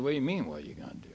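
fast, breathy whisper of a retold reply; whispered question. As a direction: hush it out quickly, almost under the breath. Old man answering quietly in hallway.

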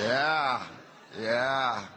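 a hearty laugh easing into a chuckled yeah, yeah; real laughter. Real, not acted. Laughing with the audience after tricking them. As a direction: laugh along with the room at the joke.

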